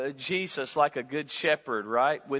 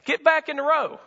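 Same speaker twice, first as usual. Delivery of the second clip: a hint of God prodding, higher and louder; God's voice; 8 semitones higher, 4 dB louder than usual. God nudging the believer back, lightly voiced and urgent. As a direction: call them back into line. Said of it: "and he says".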